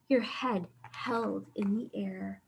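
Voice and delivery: soft hush to the little ones; whispered. Pretend. Librarian reading to children, whispered line. Proud child, held head high.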